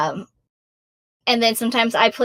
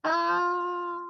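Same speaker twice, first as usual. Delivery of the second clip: ordinary delivery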